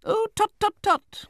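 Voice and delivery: very high, loud tutting; Betsy Trotwood. A narrator's reading. Aunt stops the widow crying, brisk scolding. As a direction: tut briskly in a high matron's voice.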